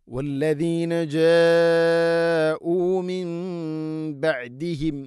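slow, drawn-out tajweed chant; Quran recitation. Shown as a demonstration. Teacher chanting the verse for students, slow and drawn out. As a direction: stretch the madd long like a reciter. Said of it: "നാലോ അഞ്ചോ ഹർക്കത്തിൻ്റെ ഖദറിൽ നീട്ടണം".

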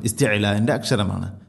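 ordinary talk

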